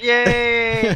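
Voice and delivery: high, drawn-out cheer; mock excited cheer. Co-host recalling his excitement at the segment, mock excited cheer. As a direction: play up the excitement on one long high note. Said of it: "I was so excited".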